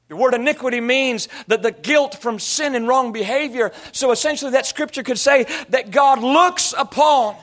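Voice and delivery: high, loud, driving sermon cadence; full-force preaching. Real, not acted. Preacher explaining the scripture to his church, full-force preaching. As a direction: drive every phrase home with gasped breaks.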